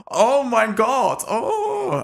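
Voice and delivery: high, slow, gushing "oh my God"; starstruck gasp. Retold or imitated. Nazi officers gushing over a war hero, high starstruck squeal.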